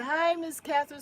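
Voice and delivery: loud, high greeting; reading greeting aloud. Retold or imitated. Reading a viewer's chat greeting, loud. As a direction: read out the hello brightly. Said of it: "She said".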